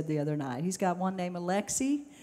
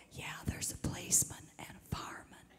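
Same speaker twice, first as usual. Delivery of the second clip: put-on hushed little boy whisper; 9 dB quieter than usual. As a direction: keep it unvoiced and secretive, like hiding. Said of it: "He whispered".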